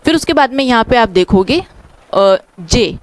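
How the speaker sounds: ordinary delivery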